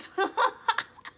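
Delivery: high, loud laughing burst; burst of laughter. Real, not acted. Laughing at grandpa's joke about the baby, a high loud burst.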